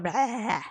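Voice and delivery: drawn-out comic noise of overwhelm; frustrated "bleh". Retold or imitated. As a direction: let out a wordless mock groan. Voices the inner conflict as noise.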